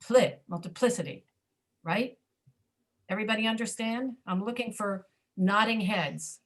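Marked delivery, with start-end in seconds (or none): 0.0-1.2 "pli, multiplicity" with PLI strong, the rest reduced; stress on PLI. Shown as a demonstration. Teacher proving stress is still heard, "multiplicity" stressed on PLI. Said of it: "You can still hear the stress on the".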